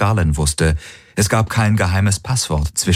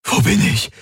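much higher, breathless question; the confused man gasps on waking; gasped; 14 semitones higher than usual. Acted. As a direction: gasp out, disoriented. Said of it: "keuchte er".

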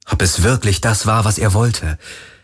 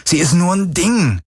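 put-on higher, agitated young man; 3 semitones higher than usual. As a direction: voice him tense and exasperated. Galen's voice.

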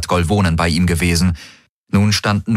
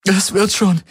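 holding back a sob; 13 semitones higher than usual. Acted. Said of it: "unterdrückte ein Schluchzen".

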